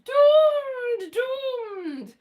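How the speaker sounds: hammed-up cry of doom; dramatic doom wail. Mock doom over crypto.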